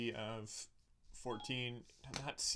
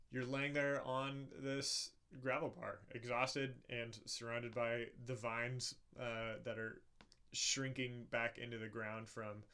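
slow, soft scene setting; calm storyteller narration; game master narration; 6 dB quieter, slower than usual. A narrator's reading. GM describes scene after battle.

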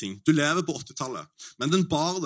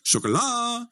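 sjokolade said much higher; 8 semitones higher, slower than usual. Shown as a demonstration. Testing the word on locals in a high tone. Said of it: "i alle mulige tonefall".

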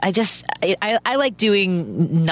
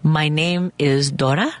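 a hint of a Mexico City accent, slow and back in the throat; lightly clenched Mexico City Spanish accent; halting English with a light Mexico City accent; slower than usual. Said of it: "it's a little more back in the throat".

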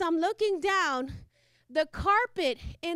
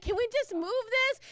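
exasperated ask; 6 semitones higher than usual. Retold or imitated. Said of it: "'cause I was like".

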